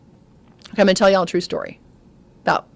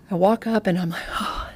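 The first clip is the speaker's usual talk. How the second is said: hushed whisper in a silent room; 6 dB quieter than usual. Retold or imitated. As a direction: whisper it so nobody hears.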